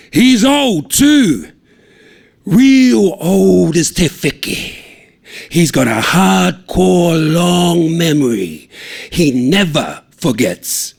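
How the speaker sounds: high, loud and slow, ringing out like a sermon; a son mimicking his preacher dad; preacher's ringing voice. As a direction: boom it out like Dad at the pulpit. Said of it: "let it ring out the way Dad does at his sermons".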